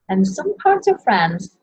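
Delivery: normal talking voice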